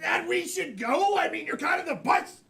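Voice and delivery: hammed-up kid's angry yell. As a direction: shout it high, acting outraged.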